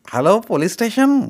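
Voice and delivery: high, loud into the phone; phone call voice. Acted. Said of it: "मारवाड्याने फोनवर आवाज दिला".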